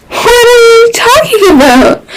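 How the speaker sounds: high, wide-eyed feigned innocence; high, mock-innocent question; innocent Reed. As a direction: play dumb with big eyes. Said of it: "Reed laughed, smile still frozen".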